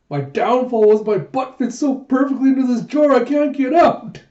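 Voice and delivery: high and loud, stuck and fed up; comic whiny complaint; exasperated squeaky voice. Acted. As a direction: wail it from inside the jar, pitch way up. Character stuck in the jar.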